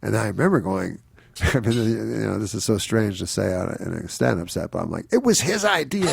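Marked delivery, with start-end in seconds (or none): 5.1-6.1 higher, defensive whine with a laugh; mock pleading. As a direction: plead your case, pitched up. Comic pleading with hostile crowd.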